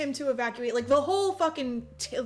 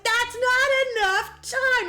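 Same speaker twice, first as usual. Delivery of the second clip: mock whine; 11 semitones higher, 5 dB louder, much slower than usual. Officials protesting.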